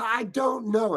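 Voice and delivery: loud, high exasperated outburst; blown top. Retold or imitated. Actor answering director at audition, blowing his top. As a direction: snap back, pitch jumping up. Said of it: "and I said it like that".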